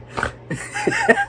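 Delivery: real high, snorting laughing fit. Real, not acted. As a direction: crack up until you snort. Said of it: "I'm snorting now".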